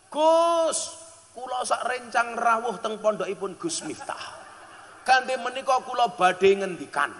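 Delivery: high, formal guest's greeting; visiting guest. Retold or imitated. Out-of-town guest addressing Gus Miftah, high and formal. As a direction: announce yourself like a formal visitor. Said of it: "rombongan Banjar saking luar kota".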